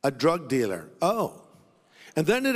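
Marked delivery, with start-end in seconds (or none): 0.0-0.9 lightly voiced, lower stranger; stranger's voice. Stall stranger admits whom he awaits, lightly voiced low. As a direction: drop lower for the man's answer. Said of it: "He said".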